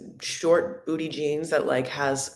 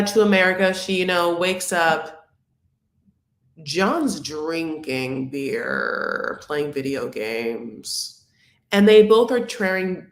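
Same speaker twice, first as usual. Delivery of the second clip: ordinary delivery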